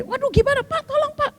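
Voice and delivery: high-pitched, flustered plea for help; acted alarm. Traveller begging a man to help her fainted friend, acted panic.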